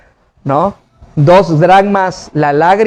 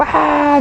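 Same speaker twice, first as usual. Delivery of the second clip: high, drawn-out imitated wail; mock wailing; 9 semitones higher than usual. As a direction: imitate a crowd of mourners crying out. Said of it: "todos gritando".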